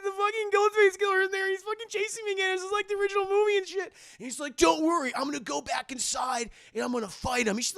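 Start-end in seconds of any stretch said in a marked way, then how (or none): 0.0-3.9 very high, frantic imitation; hammed-up horror heroine in a panic; mock panic. Retold panicked report of chase. 4.5-7.6 put-on raised, emphatic boyfriend voice; hammed-up horror-movie hero; mock hero. Retold boyfriend volunteering to fight.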